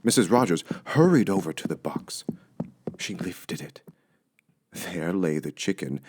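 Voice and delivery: usual speaking voice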